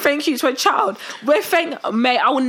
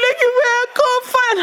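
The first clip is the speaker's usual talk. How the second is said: mock sobbing plea; 10 semitones higher than usual. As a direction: sob it out like a panicked child. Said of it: "I'm crying".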